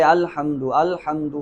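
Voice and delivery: "الحمد" with a slurred, weak opening a; "الحمد" without a firm glottal onset; unclear hamza. Shown as a demonstration. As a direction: show the mistake, gliding over the start of "الحمد". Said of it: "تو یہ حمزہ واضح نہیں ہے".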